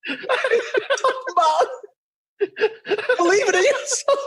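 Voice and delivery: slow, high, laughing it out. Real, not acted. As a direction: wheeze the words through laughter. Mocking his answer, laughing.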